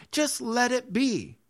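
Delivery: high, drawn-out, carefree quote; dismissive let-go. Acted. Preacher voicing someone who lets trouble go, high and slow. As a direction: stretch it out high, waving the matter away.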